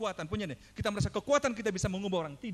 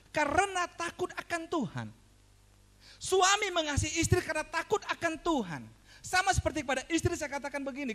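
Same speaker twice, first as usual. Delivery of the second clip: raised, emphatic pulpit delivery; forceful preaching; 9 semitones higher, 5 dB louder than usual. Real, not acted. Preacher quotes commands to wives and husbands, forceful preaching. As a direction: drive each command home to the congregation.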